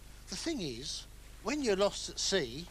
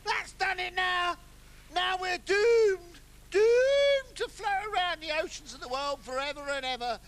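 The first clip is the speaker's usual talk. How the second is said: higher, louder hammed-up despair; melodramatic doom; 7 semitones higher, 5 dB louder than usual. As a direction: wail over the doom of it. Sail mishap, melodramatic despair.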